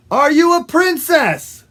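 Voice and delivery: high, loud call; loud, high-pitched question; shouted repeat. Acted. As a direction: shout it up to someone who didn't hear. Frog repeats louder to woodpecker.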